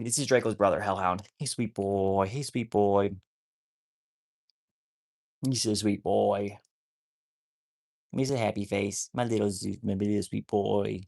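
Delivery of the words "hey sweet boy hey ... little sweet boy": doting pet talk. Real, not acted. Cooing to her wolf dog.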